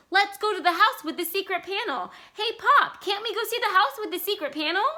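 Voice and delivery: high, loud excited plea; child pleading with Pop, very high and loud; shrieking child. Acted. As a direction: shriek it like a desperate little girl. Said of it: "June Star shrieked".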